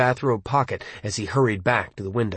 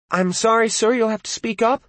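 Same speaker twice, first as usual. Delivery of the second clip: higher telephone operator voice; 11 semitones higher than usual. Acted. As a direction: play a polite phone operator, pitched up. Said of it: "the operator said".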